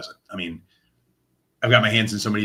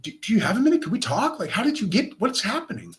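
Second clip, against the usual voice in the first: imitating a baffled cop, much higher; higher-voiced imitation of a stunned detective; 12 semitones higher than usual. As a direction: play the detective's stunned confusion. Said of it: "he's like".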